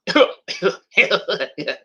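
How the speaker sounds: imitated dopey cartoon snicker; Beavis and Butthead laugh. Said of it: "which has Beavis and Butthead".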